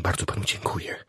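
very soft whispered thanks. A narrator's reading. Chaplain thanks patient for pointing out the man, whispering. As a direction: thank him under your breath. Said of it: "szepnął kapelan".

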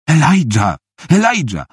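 high-pitched frightened cries; pleading, panicked calls from a trap; desperate calling. Acted. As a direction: call out for him in panic. Trapped girl calls her captor.

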